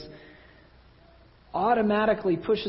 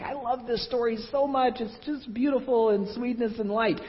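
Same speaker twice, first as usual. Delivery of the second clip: higher-pitched imitation of gushing Christians; mock a sweetly adoring fan of the story; 5 semitones higher than usual. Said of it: "I feel like Christians are like".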